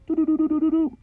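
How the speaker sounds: high-pitched rapid doo-doo sound effect; quick, high run of doo-doo-doo; imitated rolling noise. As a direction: voice a runaway vehicle noise, high. Imitates the noise of truck event.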